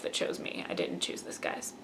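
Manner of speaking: soft secret whisper; whispered aside. Real, not acted. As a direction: confide it in a hush. Mock-confession aside, unvoiced.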